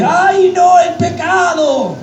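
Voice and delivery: high, slow, hammed-up little panic; mock fright. Pastor mocking a frightened sinner, high hammed-up alarm. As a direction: gasp it out like a scared churchgoer. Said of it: "Pero no te asustes".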